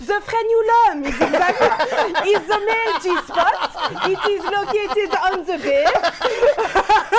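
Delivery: hammed-up French accent, fighting back laughter; mock-dramatic reading in a French accent. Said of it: "I could do it in a French accent".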